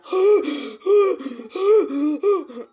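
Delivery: loud, high gasping for air; hammed-up wheezing, fighting for breath; hyperventilating gasps. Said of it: "barely can breathe".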